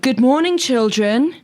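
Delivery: headteacher voice. Retold or imitated. Headteacher greeting in story.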